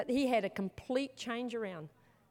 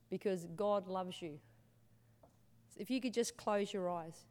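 softer, gentle altar call invitation; 3 semitones lower, 5 dB quieter than usual. Real, not acted. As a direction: invite them quietly, eyes closed.

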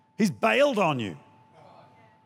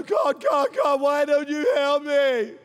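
mock whiny prayer; 5 semitones higher than usual. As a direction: whine at God for help. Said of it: "If I go".

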